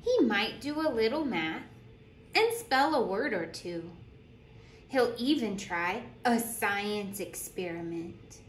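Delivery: lively storytime voice, bouncing pitch; animated picture-book reading; reading to children. Acted. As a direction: read it like a teacher at story circle. Reads picture book aloud.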